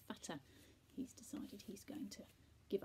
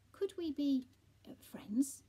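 hesitant request; 6 semitones higher, 10 dB louder, slower than usual. A narrator's reading. Shy cat asks Bella.

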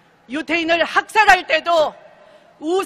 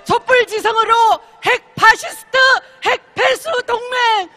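rally chant, shouted high; roaring rallying speech; 5 semitones higher than usual. Real, not acted. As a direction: lead the crowd in a fiery chant. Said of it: "우리 한번 신나게 외쳐봅시다".

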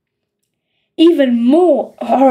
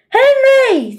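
much higher, angry scream of a name; 11 semitones higher than usual. A narrator's reading. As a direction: shriek at your son. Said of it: "screamed Mum".

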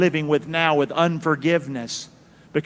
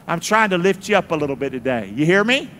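ordinary delivery

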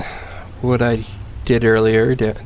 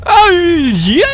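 ordinary delivery

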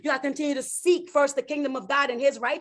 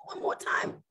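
whispered plea; 7 dB quieter than usual. Acted. Abraham begging God, trailing off.

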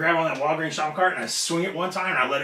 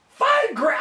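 loud, high incredulous shout; outraged disbelief; 5 dB louder than usual. Retold or imitated. Wrestler retelling hearing of five grand, outraged disbelief. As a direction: shout it back, far higher than usual. Acted outrage over pay gap.

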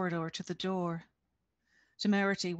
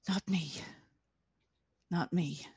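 fading to whisper; 2 semitones lower than usual. A narrator's reading. Relief turned to guilt.

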